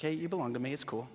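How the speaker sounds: soft, low, easy reassurance; gentle, unhurried comfort as Jesus; compassionate. Acted. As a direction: soothe a frightened friend casually. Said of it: "Jesus is so compassionate".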